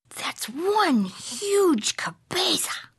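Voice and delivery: slow, awed whisper. Acted. Kitten measuring his head in the mirror, slow awed whisper. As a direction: breathe it out in wonder.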